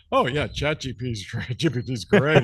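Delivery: lightly voiced, mock-enthusiastic AI; gushing AI reviewer. Host mocking AI peer review, lightly gushing. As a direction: play a touch of fawning approval. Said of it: "of course, A I would say".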